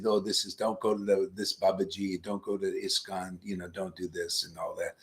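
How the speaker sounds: lightly voiced warning, hushed and low; mock hushed warning. Guru mocking rivals' warnings, hushed and low.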